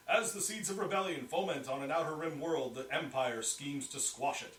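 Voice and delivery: propaganda broadcaster. Shown as a demonstration. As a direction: boom it out like wartime propaganda. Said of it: "my Imperial propaganda, or Republic propaganda voice".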